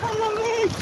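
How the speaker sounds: high cry. Real, not acted. Cold water.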